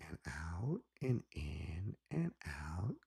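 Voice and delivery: slow breathy whisper. Shown as a demonstration. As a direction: whisper the breathing cue slowly. Coaching breathing during hypnosis.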